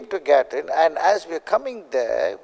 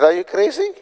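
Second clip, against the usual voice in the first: high, disbelieving question; incredulous; 6 semitones higher than usual. Retold or imitated. Telling the prophet he's crazy, mock incredulous. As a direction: scoff at the idea in disbelief.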